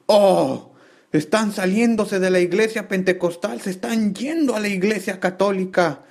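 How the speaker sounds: lightly caricatured fire-and-brimstone warning; exaggerated mock preacher. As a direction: ham up a scandalized pastor.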